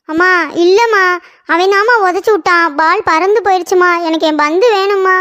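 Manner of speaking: tearful whine to mother; acted childish crying; crying complaint. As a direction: wail the accusation like an upset child. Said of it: "முத அழுகையை நிப்பாட்டு".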